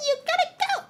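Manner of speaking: high squeaky first try at a crone; old hag. Acted. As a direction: reach for the hag voice, not there yet. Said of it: "how do I do my old hag voice?".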